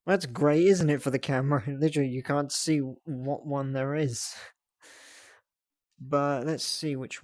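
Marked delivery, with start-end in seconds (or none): none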